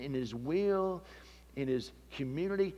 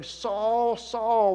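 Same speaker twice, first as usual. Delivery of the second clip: calling out; 7 semitones higher, 4 dB louder than usual. Acted. Voice of the Lord calling.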